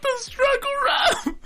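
slow, very high laughing squeal. Real, not acted. Co-host cracking up at the struggle rap, laughing. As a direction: squeal it through helpless laughter.

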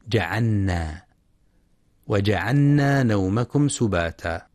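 جعلنا said with a doubled n and no l; merged lam mistake. Shown as a demonstration. Teacher showing a common error, lam merged into nun in جعلنا. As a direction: turn the l of جعلنا into n, "ja'anna". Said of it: "يدغم اللام في النون فيلفظها نونا".

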